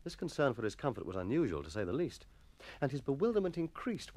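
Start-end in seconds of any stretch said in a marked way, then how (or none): none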